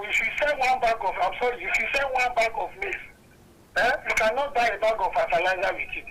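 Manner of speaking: ordinary talk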